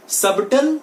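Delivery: "सबटल" with b clearly sounded; wrong subtle. Shown as a demonstration. Teacher shows what people wrongly say, b sounded. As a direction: put an audible b before the t in "सबटल". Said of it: "but that is wrong".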